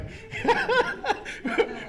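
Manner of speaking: high breathless cackle of a laugh; burst of laughter. Real, not acted. Laughing at a friend's bad drinking choices, high breathless cackle. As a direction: laugh out loud, cracking up.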